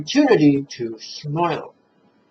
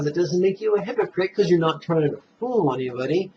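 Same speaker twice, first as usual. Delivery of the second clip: normal talking voice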